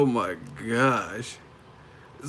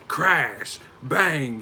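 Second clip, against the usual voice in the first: punchy, higher comic-book exclamations; Batman sound effects; 4 semitones higher, 4 dB louder than usual. Shown as a demonstration. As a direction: call them out like cartoon hits. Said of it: "I want you to have some Batman sound effects".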